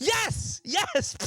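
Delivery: high delighted cheer; gleeful high-pitched yes; excited yes. Real, not acted. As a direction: jump on the joke with glee. Cheering friend's roast.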